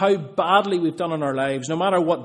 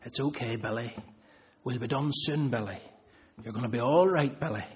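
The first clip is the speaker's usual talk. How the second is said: much softer, hushed soothing; calm a crying child in his ear; soft whispering father; 5 semitones lower, 7 dB quieter than usual. Retold or imitated. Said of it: "he whispers very quietly".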